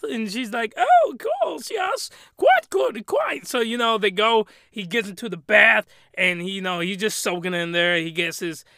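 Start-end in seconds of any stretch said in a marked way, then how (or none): 0.8-3.5 very high-pitched, slow mock maid voice; prim, eager-to-serve falsetto; obliging maid. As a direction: put on a high falsetto servant voice. Voicing the maid answering master.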